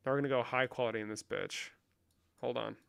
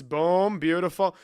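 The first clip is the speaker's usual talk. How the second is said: ordinary talk, nothing marked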